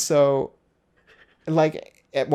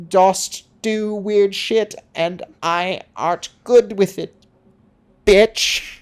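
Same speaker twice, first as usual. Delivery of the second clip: mock-archaic speech, slow, high and loud; Urianger; 8 semitones higher, 7 dB louder, much slower than usual. Weirdo answering everyone's challenge, hammed-up archaic. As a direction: declaim it grandly in fake Shakespearean. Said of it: "Why the fuck do you talk like that".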